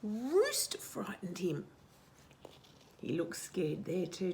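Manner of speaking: ordinary delivery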